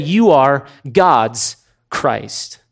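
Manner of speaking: spaced out, each word given weight; slow weighty confession. Retold or imitated. Peter confessing Jesus to the twelve, slow and weighty.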